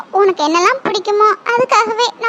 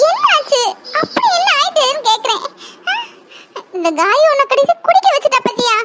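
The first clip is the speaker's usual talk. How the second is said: laughing scold; 5 semitones higher than usual. Acted. Scolding him with mocking laughter.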